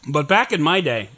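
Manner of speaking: usual speaking voice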